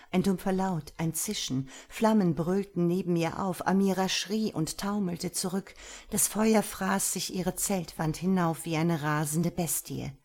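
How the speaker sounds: usual speaking voice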